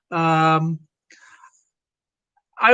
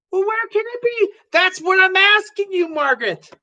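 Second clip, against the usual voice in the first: much higher, fed-up husband; exasperated husband; 10 semitones higher than usual. Acted. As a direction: throw it back at her, much higher.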